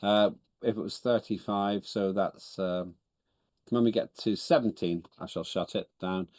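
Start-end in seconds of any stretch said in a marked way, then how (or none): none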